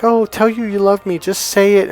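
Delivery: high, imploring mock wife voice; pleading wife. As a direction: plead for a declaration of love. Wife pleading in retold story.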